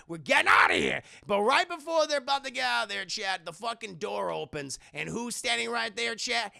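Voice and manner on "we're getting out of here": loud panicked command; acted getaway yell; urgent order. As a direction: shout it to your butler as you flee. Boss orders a hasty escape.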